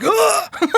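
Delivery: high mock gasp of cold shock. Imitating a diner hit by a freezing pint, shocked gasp. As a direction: gasp as if doused in ice.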